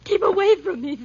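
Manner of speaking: loud, high cry to back off; cornered, fend him off at full voice; panicked warning. Acted. Cornered at window, fears blow.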